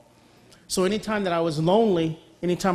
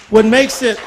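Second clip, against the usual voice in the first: emphatic burst; 5 semitones higher, 12 dB louder than usual. Real, not acted. Preacher stresses the point to congregation.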